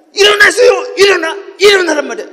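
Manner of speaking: high, forceful yell to get up; full-voiced preacher's command; loud shouted command. Shown as a demonstration. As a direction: shout it insistently, phrase after phrase. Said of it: "큰 소리를 했다는 거야".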